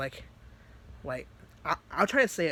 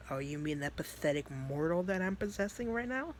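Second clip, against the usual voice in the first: soft, creeping scorn, rising gradually; condescending demon; 5 dB quieter than usual. Acted. Demon Vice about the mortal he possesses, softly condescending. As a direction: toy with her in a quiet voice. Plays Vice answering Natsuki.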